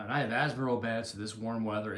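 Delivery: ordinary talk, nothing marked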